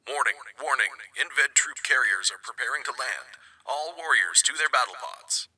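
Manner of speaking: a distant alien warrior calling the alert; booming voice. Acted. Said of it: "the booming voice of his Zentradi announced".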